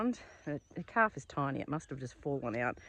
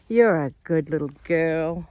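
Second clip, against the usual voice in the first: slow, louder, tender praise; 10 dB louder, slower than usual. Real, not acted. Owner praising the newborn calf, tender baby talk. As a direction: praise the little calf lovingly.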